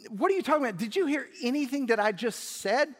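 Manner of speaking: high, loud exasperated outburst; frustrated son shouting back at his father. Acted. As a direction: throw up your hands in disbelief. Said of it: "The son is frustrated".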